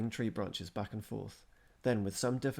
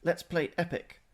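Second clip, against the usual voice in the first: imperious; 9 semitones higher, 6 dB louder than usual. A narrator's reading. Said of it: "she hailed them imperiously".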